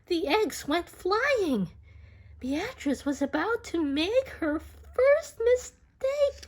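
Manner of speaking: rising, slow and tense; dramatic build. A narrator's reading. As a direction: build suspense as the eggs fly. Dramatic moment of slipping eggs.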